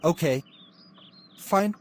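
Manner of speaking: ordinary talk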